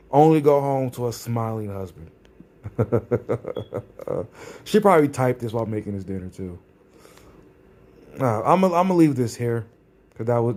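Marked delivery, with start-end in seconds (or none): 2.6-4.2 low, quiet run of chuckles; derisive chuckle. Real, not acted. Vlogger laughing about her smiling husband at home, low chuckle. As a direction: chuckle at your own jibe.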